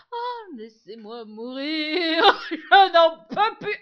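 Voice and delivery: slow, loud laughing wail. Real, not acted. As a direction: laugh through mock despair. Laughing despair.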